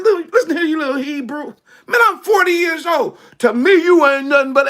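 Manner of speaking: angry Pharaoh. Acted. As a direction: bark it at him like a furious pharaoh. Pharaoh yells at Moses.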